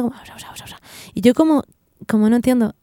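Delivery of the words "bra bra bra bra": mimicked crowd babble, very quiet; low murmur of imitated gibberish; imitated chatter. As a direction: mumble nonsense syllables like background chatter. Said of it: "los miraba a todos como conversando".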